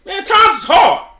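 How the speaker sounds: high loud outburst; shouting out. Real, not acted. Telling viewers times are hard, loud shout. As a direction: shout it to the sky, exasperated.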